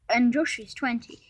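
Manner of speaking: usual speaking voice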